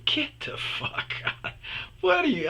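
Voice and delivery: very high-pitched retold incredulity; comic high-voiced astonishment at flattery; mock disbelief. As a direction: squeal it in hammed-up disbelief. Acts out his disbelieving reaction.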